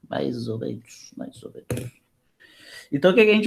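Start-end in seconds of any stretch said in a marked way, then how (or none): 0.1-1.1 quiet mutter. Real, not acted. 1.1-1.9 whispered under the breath, voiceless. Real, not acted.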